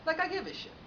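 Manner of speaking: high-pitched scornful aside; dismissive aside. Acted. Comedian dismissing the client's life story, scornful.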